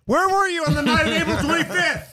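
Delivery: mock interrogation, high and loud, laughing; tough cop. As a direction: grill him like a hammy detective. Mock interrogation after "math check.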